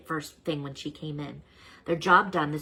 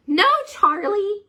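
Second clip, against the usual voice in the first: much higher, louder, slow teacher voice; reassuring teacher; 10 dB louder, much slower than usual. Acted. Miss Beasley reassuring Charlie, high and slow. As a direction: gently set the boy's mind at ease.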